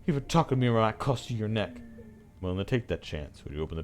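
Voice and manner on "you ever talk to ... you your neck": high, loud start dropping to a quick threat; acted hateful threat; boiling hatred. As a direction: warn them off with seething hatred. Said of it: "voice throbs with pure, boiling hatred".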